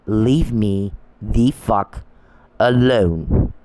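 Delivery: slow, separated "Leave me the fuck alone"; emphatic demand. Retold or imitated. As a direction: pause before "alone", weighting each word. Repeats the demand word by word.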